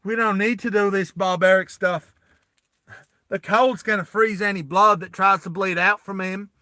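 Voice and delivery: Roy cracking a joke to the squad; joking quote. Retold or imitated. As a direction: toss off the line as a wisecrack. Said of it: "Roy makes a joke like".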